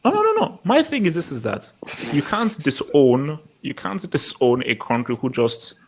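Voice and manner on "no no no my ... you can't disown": ordinary talk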